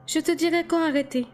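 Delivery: high-pitched female character voice; Alice promising. A narrator's reading. Alice promises to guide Edward, high reassuring voice. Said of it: "me promit Alice".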